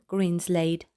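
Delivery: usual speaking voice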